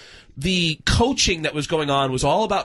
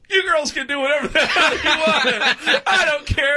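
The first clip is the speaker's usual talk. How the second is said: higher, laughing don't-care delivery; dismissive retold reply; 7 semitones higher than usual. Retold carefree reply to girls.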